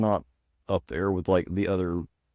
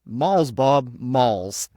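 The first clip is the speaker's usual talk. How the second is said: put-on Southern accent; 3 semitones higher, 6 dB louder, much slower than usual. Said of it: "your cracker accent is turning that W into L's".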